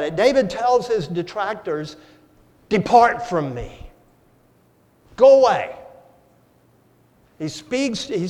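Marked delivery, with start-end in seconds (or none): 2.7-5.7 rebuking. Retold or imitated. David rebukes his detractors.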